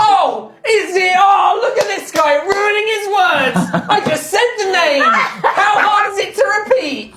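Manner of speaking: loud, high-pitched exasperated rant; mock outraged rant. Host berating a player for fluffing his words, mock outraged.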